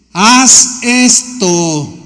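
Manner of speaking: very slow, each word weighted; slow emphatic command. Acted. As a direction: lay down the command slowly. Jesus commands the lawyer.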